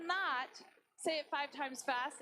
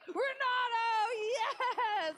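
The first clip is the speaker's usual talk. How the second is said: loud, high cheer; excited shout; 7 semitones higher, 5 dB louder, much slower than usual. Real, not acted. Host hails the last player left, excited shout. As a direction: call it out louder and higher, thrilled.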